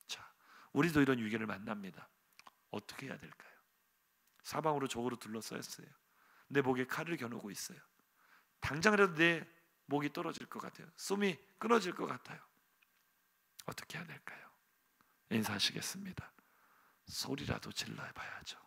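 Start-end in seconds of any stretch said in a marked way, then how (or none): 2.7-3.5 hushed unvoiced whisper. Preacher whispering to the congregation, hushed. 13.6-14.5 faint breathy whisper. Acted. Preacher repeating the question, fainter whisper.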